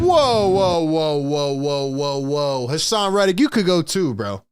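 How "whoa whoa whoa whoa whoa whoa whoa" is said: alarmed, halting whoas; startled, then settling into repeated whoas; shocked whoa. Real, not acted. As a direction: stop everything, that's outrageous. Stopping the clip in shock.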